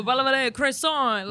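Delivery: slow mock French gibberish; hammy fake French accent. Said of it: "pretend to speak French".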